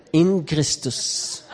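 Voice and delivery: drawn-out In Christus; very slow. Shown as a demonstration. Preacher repeating In Christus to the congregation, very slow. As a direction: savour In Christus slowly. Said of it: "repeated slowly".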